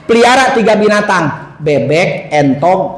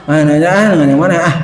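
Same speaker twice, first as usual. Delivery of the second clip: lazy cat wife; 7 semitones lower than usual. Acted.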